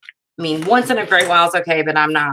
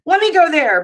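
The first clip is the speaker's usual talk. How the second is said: eager; 8 semitones higher, 3 dB louder than usual. Retold or imitated. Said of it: "I would be like".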